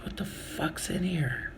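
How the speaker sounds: slow whisper, baffled at the light bag; whispered, slowed-down suspicion; whispered disbelief. Retold or imitated. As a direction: hush it like you can't believe it. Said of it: "and I'm like".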